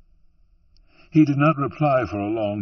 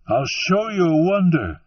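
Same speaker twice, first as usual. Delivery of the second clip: higher and louder proclamation; cried out; 9 semitones higher, 5 dB louder than usual. A narrator's reading. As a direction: call it out to the whole room. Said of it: "he cried at them".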